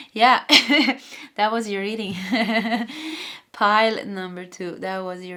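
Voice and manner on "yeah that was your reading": real laughs between the words; relieved wrap-up with bursts of laughter; laughing. Real, not acted. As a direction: break into laughter around each phrase. Laughs on finishing an intense reading.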